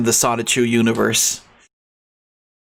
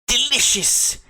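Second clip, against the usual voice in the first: sudden high squeaky voice; high squeak; 12 semitones higher than usual. Pretend. Squeaky delicious.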